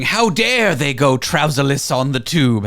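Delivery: lightly put-on posh British accent, slow; a hint of a stuffy upper-crust Brit, slow; mild posh British scold, slow. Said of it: "How was my accent there?".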